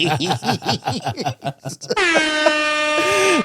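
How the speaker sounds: squealing high laugh. Real, not acted. Laughing at own story.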